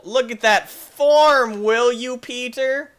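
loud, high, drawn-out mock commentary; excited commentary. Player to Peter about the form, excited mock commentary. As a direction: show off the stunt to a co-commentator.

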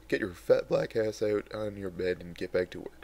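plain reading voice

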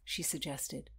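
plain reading voice

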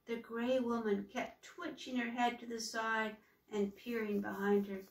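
plain reading voice

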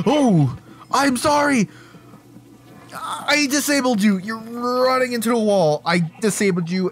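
high-pitched, exaggerated apology; mock-earnest apology. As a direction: plead high with playful over-earnestness.

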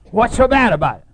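loud, high indignant challenge; angry chairman. Retold or imitated. Angry chairman challenges Harry's verdict, loud and high. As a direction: bark it back, offended.

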